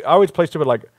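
ordinary talk, nothing marked

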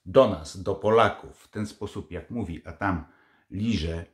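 ordinary delivery

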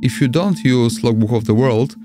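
ordinary talk, nothing marked

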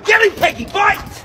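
high loud command to the dog; shouting at dog. Acted. Man urging his dog to attack, loud high shout. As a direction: yell it at the dog.

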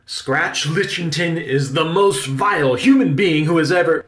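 loud, high, theatrical outrage; building, projected indignation; indignant rant. Acted. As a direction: denounce the critic with stage force. Uncle rants about critic.

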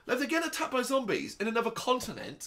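high-pitched disbelieving protest; incredulous. Retold or imitated. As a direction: question it in baffled disbelief. Acts out his disbelieving reaction to plot.

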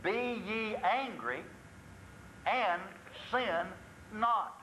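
Be ye angry, and sin not, each word set apart with long pauses; slow scripture quote. Shown as a demonstration. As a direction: lay out the verse slowly, word by word. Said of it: "Here's what the Bible says about anger".

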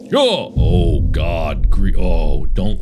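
usual speaking voice

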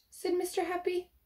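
ordinary delivery

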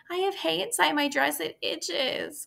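put-on high whiny girl's voice; calling out. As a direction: complain like an itchy little girl. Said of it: "called Fern".

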